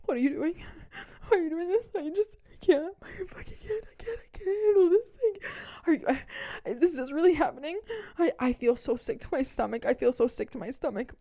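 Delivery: high, wailing, sobbing panic; mock crying boyfriend. The panicked ex sobbing at her, mock crying. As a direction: whine like a man falling apart.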